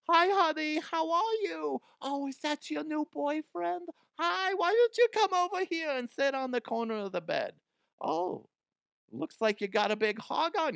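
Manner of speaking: high-pitched cooing mom impression; Adam Sandler's mom voice. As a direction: put on a high, cooing mama voice. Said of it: "his mom voice".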